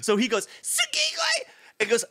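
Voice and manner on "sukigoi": very high squealed yell; mock yelp. The boy yelping as his bike launches. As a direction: yelp it as you wipe out.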